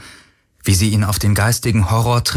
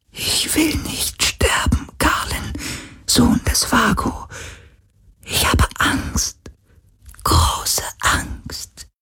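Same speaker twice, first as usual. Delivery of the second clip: frightened ghostly whisper; 4 dB quieter, slower than usual. Acted.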